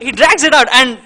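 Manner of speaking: laughing at his own impression. Real, not acted. Caster cracking up after his Wire impression, laughing.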